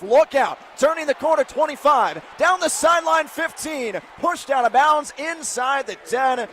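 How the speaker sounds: rising, loud play-by-play shout; excited run call. Real, not acted. Announcer calling a long run down the sideline, shouting. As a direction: build the call as the runner breaks free.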